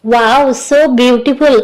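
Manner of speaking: slow teasing. Acted. Husband teasing wife about marks.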